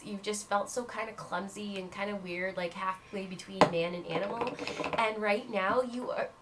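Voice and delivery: ordinary talk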